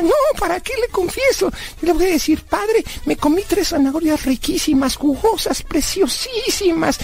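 put-on high-pitched kid gushing over his treat; high cartoonish mock confession; childlike Blas, relishing. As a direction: savour the list in a high character voice.

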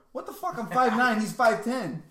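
loud outraged disbelief; indignant and loud; exasperated. Real, not acted. Outraged retelling of dad.

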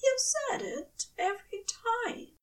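high fussy angel voice; Aziraphale voice. A narrator's reading. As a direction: lift up into the angel's gentle voice.

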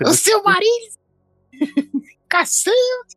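very high falsetto cry; squeaky excited exclamation. Acted. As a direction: shriek it in falsetto. Joking exclamation.

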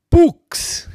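high, loud ghostly hoot; ghostly boo. Acted. Wilt delivering his ghost joke punchline, spooky hoot. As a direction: moan it out like a cartoon ghost.

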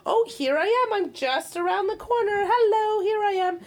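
put-on high, bright-toned narrating to baby; high singsong parent announcing her way; singsong broadcasting. Shown as a demonstration. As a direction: sing it out like calling down the hall to baby. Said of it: "broadcasting everything you're doing".

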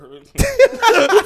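loud burst of laughter. Real, not acted. Laughing at own joke.

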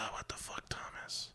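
exasperated sigh into a whisper; whispered inner thought. Acted. Customer privately reacts to the clerk, exasperated whisper. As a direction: breathe it out, fully whispered, as if thinking it.

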